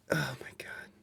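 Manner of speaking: breathy whispered oh my God; fed-up whisper; whispered exasperation. Real, not acted. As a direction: whisper it in defeat. Exasperated at tech failure.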